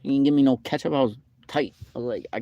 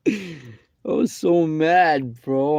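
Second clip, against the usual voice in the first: very slow, louder and higher; drawn-out mad; 5 semitones higher, 5 dB louder, much slower than usual. Real, not acted. Telling viewers how mad he was, drawn out slowly. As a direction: stretch every word out in annoyance.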